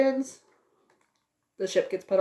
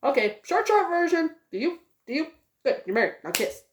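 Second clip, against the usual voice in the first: rushed priest; 8 semitones higher than usual. Retold or imitated. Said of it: "'cause he's like".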